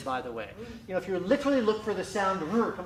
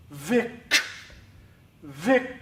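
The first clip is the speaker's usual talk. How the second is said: "Vic" as one clipped, separate syllable; staccato; 2 semitones lower, 6 dB louder than usual. Shown as a demonstration. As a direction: isolate "Vic" alone, punched out. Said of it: "take it syllable by syllable, very methodically".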